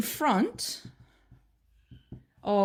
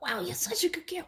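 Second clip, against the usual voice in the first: high-pitched, softer cooing praise; dog praise; 9 semitones higher, 5 dB quieter than usual. Acted. Trainer walks up to barking dog, high gushing praise.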